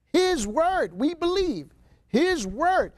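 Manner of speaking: "His word" pitched high with firm stress; emphatic declaration. Acted. Voicing the Samaritans' emphatic declaration.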